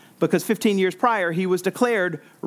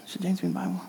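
imitated doubters, much softer; hushed aside; 8 dB quieter, much faster than usual. Preacher voicing early doubters of James, hushed aside. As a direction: drop the repeated question to an undertone.

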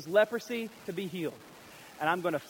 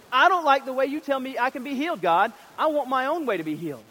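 higher, louder whiny protest at God; petulant complaint; 7 semitones higher, 7 dB louder than usual. Acted. Naaman complaining to God, loud and petulant. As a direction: sulk loudly like a spoiled man. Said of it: "he's like".

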